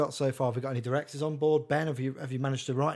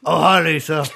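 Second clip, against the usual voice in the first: a low, hoarse creep voice, much louder; creepy deep Denny; 2 semitones higher, 14 dB louder than usual. Acted. [name] reading Denny, greeting Lisa in a creepy low growl.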